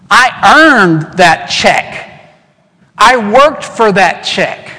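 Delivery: slow, offended insistence that it was earned; a worker refusing a gift, mock indignant and slow; indignant refusal. Worker refusing boss's gift check.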